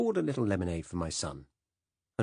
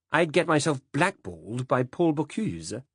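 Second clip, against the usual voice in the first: higher, louder, a touch pompous; smug snob; 8 semitones higher, 5 dB louder than usual. Acted. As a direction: play the pompous food snob. Dickie's smug joke.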